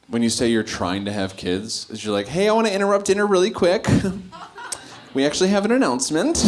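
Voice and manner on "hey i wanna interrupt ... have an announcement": higher, fast, chirpy announcer parody; mock announcement. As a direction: interrupt dinner like a smug couple. Mocking couple's announcement.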